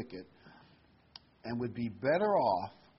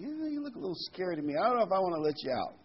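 wary guy; 6 semitones higher, faster than usual. Retold or imitated. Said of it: "The guy looks at this genie".

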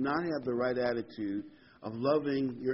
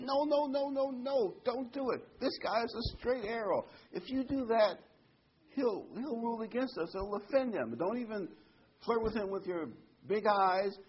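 high-pitched, urgent mock protest; alarmed warning; 10 semitones higher than usual. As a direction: act out a lawyer heading off a bad idea, pitched way up. Lawyer urgently warns client in story.

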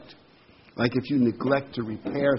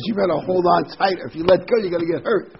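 training sergeant; 5 semitones higher, 4 dB louder than usual. Retold or imitated. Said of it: "the training sergeant says".

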